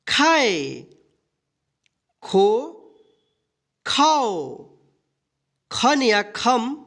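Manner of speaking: teacher drilling the barakhadi aloud; slow recitation drill. Shown as a demonstration. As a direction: recite each syllable slowly with long pauses. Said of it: "ख का ककारा यहाँ पे सीखेंगे".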